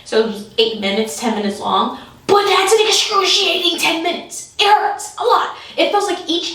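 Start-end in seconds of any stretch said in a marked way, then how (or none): 2.3-6.5 emphatic complaint. Real, not acted. Stressing how painful it was.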